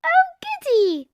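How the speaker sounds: very high, slow squeal of joy; delighted Peppa. A narrator's reading. Peppa thrilled to help shop, high and slow. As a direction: savour the treat like a small child. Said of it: "said Peppa".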